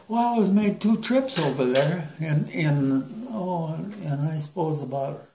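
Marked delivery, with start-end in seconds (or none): none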